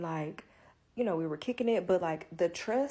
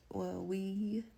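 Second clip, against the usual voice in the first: ordinary talk, nothing marked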